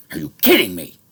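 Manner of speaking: furious disbelief, screamed at the top; outraged shout. Real, not acted. Venting about his island, a furious screamed question.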